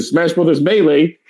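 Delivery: usual speaking voice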